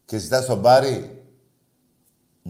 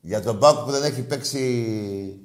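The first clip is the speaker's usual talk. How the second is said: slow, each word spelled out in scorn; slow sarcastic take; slower than usual. Acted. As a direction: drag it out to rub it in. Host repeats mocking phrase.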